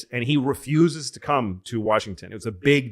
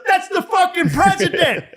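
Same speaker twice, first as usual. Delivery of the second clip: very high laughing shout; startled bystander shout; 7 dB louder than usual. Retold or imitated. Bystander spotting the president, high laughing shout. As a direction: yell it, half laughing in disbelief.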